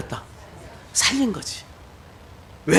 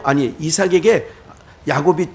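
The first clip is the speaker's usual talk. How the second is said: usual speaking voice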